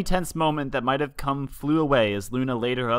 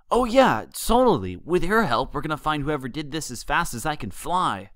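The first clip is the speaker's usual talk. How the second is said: eager; 4 semitones higher than usual. A narrator's reading. Dash snapping back, eager.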